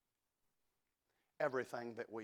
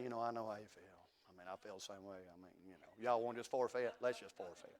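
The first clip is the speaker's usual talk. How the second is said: mopey coach; 5 semitones lower, 6 dB quieter, faster than usual. Retold or imitated. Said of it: "a coach that's over there moping around".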